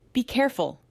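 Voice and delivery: snapped. Acted. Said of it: "Alba snapped".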